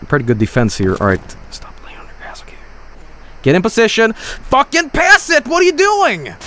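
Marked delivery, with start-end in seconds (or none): 1.5-2.5 very soft, whispered scolding; hushed irritation; whispered grumble. Real, not acted. Muttering at fallen player. 4.1-6.3 high, drawn-out exasperated cry; furious gamer outburst; frustrated yelling. Real, not acted. Scolding players mid-game.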